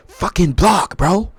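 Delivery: high, loud mock-angry yell; badly imitated furious quarterback barking at his lineman; half-serious impression of an angry teammate; Patrick Mahomes. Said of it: "I don't even know how to talk in Patrick's voice".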